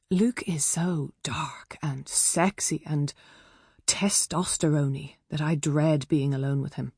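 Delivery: plain reading voice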